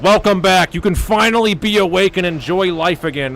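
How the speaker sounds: hammed-up jubilant greeting, loud and high; loud mock welcome. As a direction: shout it out like a triumphant rescuer. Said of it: "And I'm like".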